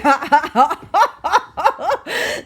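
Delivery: big loud laugh; long rising laugh. Acted. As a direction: let it roar. Elephant laughs hard.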